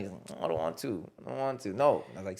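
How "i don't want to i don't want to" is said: put-on low, soft sulky kid; imitated tearful reluctance. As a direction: mimic a crying child refusing under his breath. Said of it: "he's still crying".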